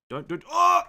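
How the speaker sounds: sudden high loud yelp; startled yelp. Real, not acted. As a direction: cry out in alarm. Startled while camera slips.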